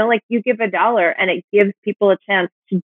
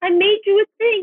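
mock eager offer; 8 semitones higher than usual. S0 mimicking a creator's offer.

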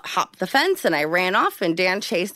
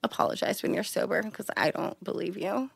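hushed cold reply; 9 dB quieter than usual. Retold or imitated.

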